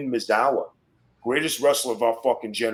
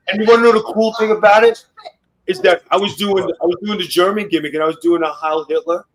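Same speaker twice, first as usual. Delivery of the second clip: louder, higher, building excitement; excited; 6 semitones higher, 7 dB louder than usual. Real, not acted. Wrestler telling podcast hosts about the crowd, excitedly. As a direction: hype up the memory, voice climbing.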